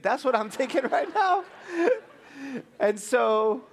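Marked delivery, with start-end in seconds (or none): none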